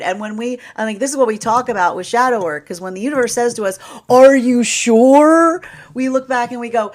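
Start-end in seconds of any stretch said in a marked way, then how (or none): 4.1-5.6 drawn-out, loud, skeptical "are you sure"; a loud, slow, teasing challenge; mock doubtful challenge. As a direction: slowly question their certainty, teasing. Universe voiced asking.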